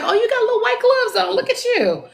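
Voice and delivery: high, delighted teasing praise; admiring tease. Retold or imitated. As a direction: coo over her little gloves. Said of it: "I'm like".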